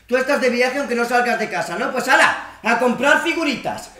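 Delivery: loud, high impression of mum ordering; bossy mother. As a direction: imitate a mother overriding her child, full volume. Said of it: "mamá".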